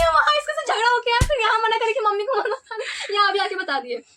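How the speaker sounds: high, loud mimicked telling-off; mock scolding. Grandmother telling off her tattling daughter, mock scolding. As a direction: act out an exasperated grandmother's high-pitched rant.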